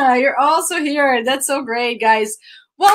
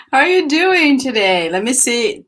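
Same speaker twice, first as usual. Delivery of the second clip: ordinary talk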